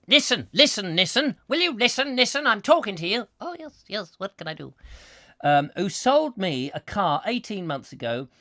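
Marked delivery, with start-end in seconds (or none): 0.1-3.3 high, loud, insistent demand for attention; hammed-up exasperated caller; mock telling-off. 3.4-4.7 soft, put-on answering voice; reply in a small, softer character voice; meek comic receptionist; mock garage employee.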